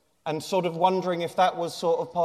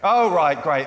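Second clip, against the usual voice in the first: ordinary talk, nothing marked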